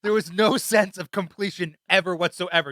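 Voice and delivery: loud laughing complaint. Real, not acted. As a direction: laugh through the words.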